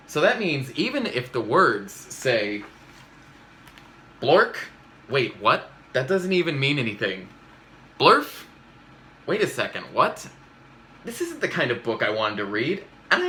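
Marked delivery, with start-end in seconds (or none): 4.2-4.6 "Blork" popped out loud and higher; goofy made-up word blurted out; silly nonsense word. Acted. Reading a nonsense word aloud. 8.0-8.4 "Blurf" squeaked much higher and louder; goofy startled nonsense word; silly nonsense word. Acted. Reading a nonsense word aloud.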